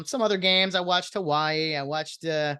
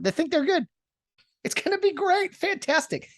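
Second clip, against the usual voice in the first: gleeful mockery; 11 semitones higher than usual. Real, not acted. Mocking teams, gleeful.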